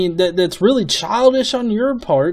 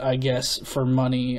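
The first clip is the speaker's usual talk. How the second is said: usual speaking voice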